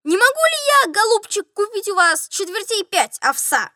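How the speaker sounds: high-pitched, wheedling request; doctor's request. A narrator's reading. Doctor asking steward to sell oats, high-voiced. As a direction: start high and ease down. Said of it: "обратился к нему доктор".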